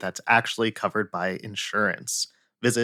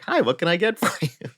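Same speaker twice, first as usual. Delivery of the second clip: higher, mock machine greeting; automated greeting again; 6 semitones higher than usual. Closed Wendy's machine greets again, same mock robotic tune. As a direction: copy the recording exactly, same tune. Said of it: "same voice, same, like, intonation".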